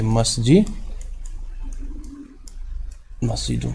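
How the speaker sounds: مسجد held open as "masji", long pause, then مسجدن ending on n; split-word demonstration. Shown as a demonstration. Teacher pointing out the noon sound, مسجدن built up after a pause. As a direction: say "masji", wait, then complete مسجدن with the final n. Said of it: "کیونکہ نون کی آواز آتی ہے".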